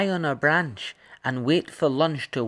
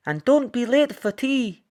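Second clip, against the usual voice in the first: higher, louder cheerful mother; mum's voice; 13 semitones higher, 6 dB louder than usual. Acted. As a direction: send her off like a cheery mum. Red's mum sees her off.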